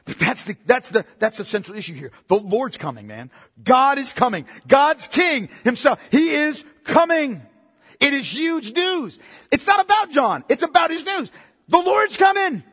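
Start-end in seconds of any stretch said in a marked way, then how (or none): 3.6-12.6 rising, louder proclamation building to a peak; building preaching. Real, not acted. Preacher telling the church God's king is coming, louder and higher.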